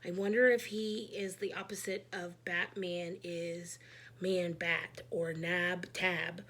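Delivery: ordinary delivery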